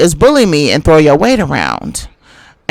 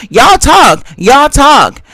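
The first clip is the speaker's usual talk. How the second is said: shouted retort; 5 semitones higher, much slower than usual. Real, not acted. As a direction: shout her down. Heated argument, shouting her down.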